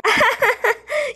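high-pitched mocking laugh. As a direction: laugh at him, high and teasing. Laughs at the bird fear.

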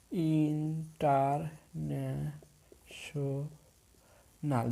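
very slow, drawn-out syllables with gaps in international. Shown as a demonstration. Teacher showing students a polysyllable, word broken up slowly. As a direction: break international into stretched, separate syllables. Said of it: "ভেঙে ভেঙে উচ্চারণ করেন".

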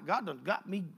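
normal talking voice

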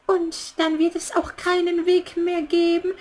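ordinary talk

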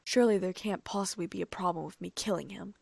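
plain reading voice

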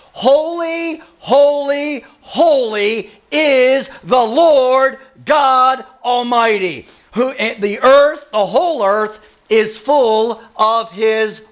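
slow, ringing pulpit declaration; emphatic, measured preacher's cry; proclaimed scripture. Acted. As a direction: proclaim it to the congregation, stretching each key word.

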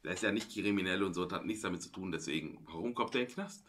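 normal talking voice

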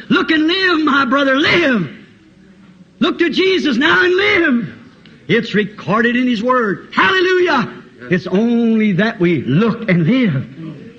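fervent spoken hymn quotation; preached song lyric. Real, not acted. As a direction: proclaim the lyric like an exhortation. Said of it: "As the song says".

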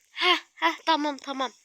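normal talking voice

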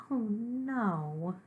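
high, drawn-out groan of dismay; dismayed sigh. A narrator's reading. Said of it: "Gorp sighed".

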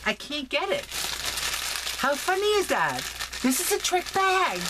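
squeaky, high, amused frustration; high exasperated laugh-talk. Real, not acted. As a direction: pitch up in giddy frustration. Struggling with trick bag, laughing.

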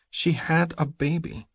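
slow, high female character voice; pleading woman. A narrator's reading. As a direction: insist gently in a high, drawn-out voice. Stacy pleads for the creature.

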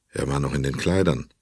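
ordinary delivery

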